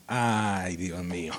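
low, soft sigh of a plea; mock weak desperation. Imitating someone supposedly desperate, low and soft. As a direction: let it sink away feebly. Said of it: "Cuando usted está desesperado".

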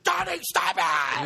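slow shouted mock scold; freaking out. Wife scolding her husband, slow mock shout. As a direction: yell at him to stop. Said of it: "freaks out".

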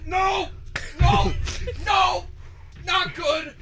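high pained yells; shouting in pain. Real, not acted. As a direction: yell out from the burning heat. Reacting to hot pepper burn.

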